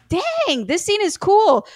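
high-pitched impressed reaction; retold excitement. Viewer recalls admiring a film scene, playfully excited and high. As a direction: re-enact being wowed, voice jumping up.

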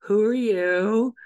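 high, drawn-out mock question; slow, lilting pretend interrogation; playful questioning. Playfully quizzing the entity that appears.

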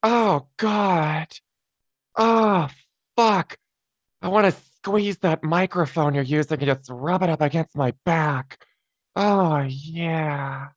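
breathy high sultry purr, drawn out; high, breathy Coolidge voice; Jennifer Coolidge impression. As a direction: purr like a tipsy starlet. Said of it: "Let's start with your favorite, Jennifer Coolidge".

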